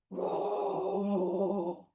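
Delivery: long, drawn-out roar; play-roar like a big beast; big animal roar. Pretend. Teacher voices a mystery animal for children, big roar. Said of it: "Where's the big roar?".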